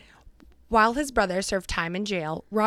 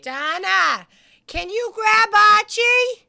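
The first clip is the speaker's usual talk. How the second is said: slow, high, loud holler; drawn-out raised call; 11 semitones higher, 6 dB louder, much slower than usual. Real, not acted. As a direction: holler for her help across the house. Calling out to Donna for help.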